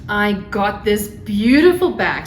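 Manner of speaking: ordinary talk, nothing marked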